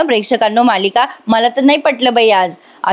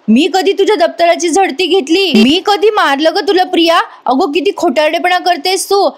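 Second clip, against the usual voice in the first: put-on higher, hurt old man protesting his innocence; an old man crying out that he is wrongly accused; Madhubhau pleading; 6 semitones higher than usual. Madhubhau denies Priya's lies to her face, in a higher hurt protest. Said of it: "आता हे मधुभाऊ म्हणतात".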